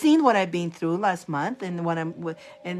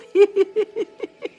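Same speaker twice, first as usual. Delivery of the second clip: laughing; 13 semitones higher, 6 dB louder than usual. Real, not acted. Laughing at the story.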